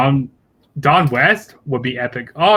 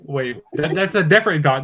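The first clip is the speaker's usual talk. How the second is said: ordinary talk, nothing marked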